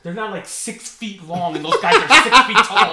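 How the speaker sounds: laughing while talking. Real, not acted. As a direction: laugh through the words. Laughing through the argument.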